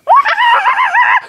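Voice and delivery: high shrieking evil laugh; evil cackle. Pretend. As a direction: cackle like a villain. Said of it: "Don't speak in an evil voice".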